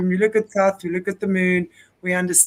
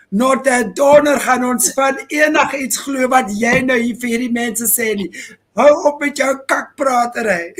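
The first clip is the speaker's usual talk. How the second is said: scolding in Afrikaans, slightly raised; Afrikaans ancestor; 3 semitones higher than usual. Acted. Ancestor scolding the speaker, in Afrikaans. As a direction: tell them off in Afrikaans, raised and emphatic. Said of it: "my Afrikaans ancestors telling me".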